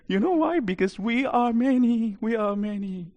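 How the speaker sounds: high, eerie demon; spooky, high-voiced demonic reply; creepy demon voice. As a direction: let it trail away like a creepy spirit. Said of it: "he said in a creepy voice".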